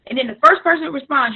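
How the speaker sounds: ordinary delivery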